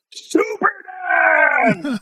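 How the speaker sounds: long, high, drawn-out holler; Cliff Stewart's booming call. Retold or imitated. Storyteller imitating an old master hailing him between classes, booming holler. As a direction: stretch it out like a far-carrying call. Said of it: "I hear this booming voice, Cliff Stewart".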